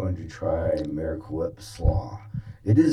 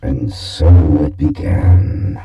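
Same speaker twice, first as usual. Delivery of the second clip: epic fantasy narrator; 4 semitones lower, 9 dB louder than usual. Acted. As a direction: narrate it like a fantasy movie trailer.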